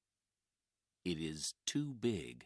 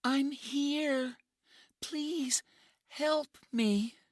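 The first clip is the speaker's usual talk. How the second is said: put-on young woman pleading; 7 dB louder, slower than usual. Injured woman calls for help.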